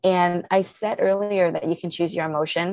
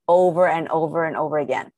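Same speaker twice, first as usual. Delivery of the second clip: usual speaking voice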